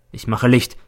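ordinary delivery

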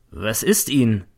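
ordinary delivery

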